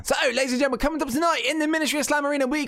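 high, fast ring announcer hype; wrestling announcer. Acted. Hosting a wrestling show intro.

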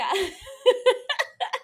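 high breathy giggle; a real little laugh at herself. Real, not acted. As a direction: bubbly laugh bursting out. Laughing about Amy Adams.

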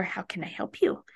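softly voiced offer of help; mock-up of a quiet reply to a toddler; gentle parent reply. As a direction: quote a parent's hushed, attentive answer. Mother voices her attentive reply.

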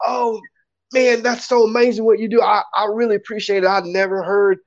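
high-pitched, gushing fan thanks; heartfelt gratitude. Retold or imitated. Drunk stranger thanking the poet for his work, gushingly grateful. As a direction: imitate a drunk fan overflowing with appreciation. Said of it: "real with a lot of gratitude".

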